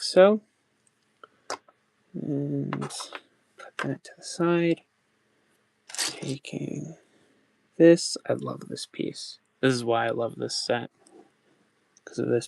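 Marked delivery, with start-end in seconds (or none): none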